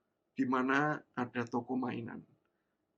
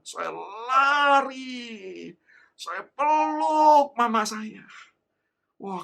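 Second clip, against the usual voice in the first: ordinary talk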